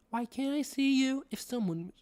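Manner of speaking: high put-on character voice; Rory. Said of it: "I'm gonna do Rory's voice".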